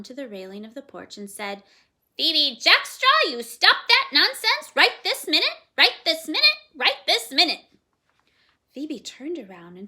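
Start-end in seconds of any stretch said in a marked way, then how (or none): 2.2-7.6 scolding parrot. A narrator's reading. Parrot on the porch rail scolding the girl, loud squawk. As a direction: squawk the order like a cross bird.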